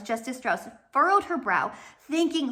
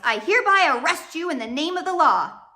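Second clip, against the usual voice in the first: higher, louder stern arrest; harsh announcement; 6 semitones higher, 6 dB louder than usual. Acted. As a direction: declare it with official force. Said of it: "announced in a harsh voice".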